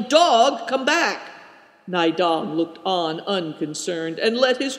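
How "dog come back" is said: loud, high call after a runaway dog. A narrator's reading. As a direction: shout across the river for the dog to come back.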